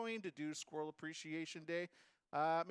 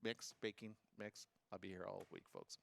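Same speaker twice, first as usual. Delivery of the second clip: comedian sign-off; 7 semitones lower, 7 dB quieter than usual. Acted. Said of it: "Get that?".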